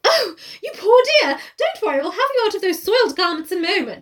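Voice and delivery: high, fussing polite lady; fairy godmother. Acted. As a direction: coo over her kindly. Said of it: "she's so polite".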